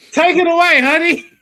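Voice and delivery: high, loud, sing-song handoff; mock sweet handoff. Host playfully passes the segment to cohost, high and loud. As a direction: hand the mic over with sugary teasing. Handing over with a put-on.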